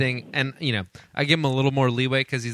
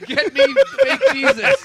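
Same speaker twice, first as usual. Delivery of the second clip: much higher, slow, loud squeal; 5 dB louder than usual. Retold or imitated. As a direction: squeal the demand slowly. Acting Mel Gibson demand.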